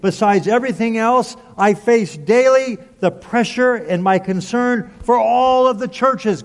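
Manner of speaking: high, forceful recital of sufferings; impassioned preaching. Acted. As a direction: pound out each hardship like a preacher building a case. Preaches Paul's list of hardships.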